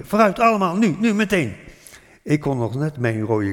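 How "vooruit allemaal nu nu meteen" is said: urgent, higher, louder call; retold shout. Father hurrying family to the cellar, urgent shout. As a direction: hurry everyone down like an alarmed father. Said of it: "riep hij".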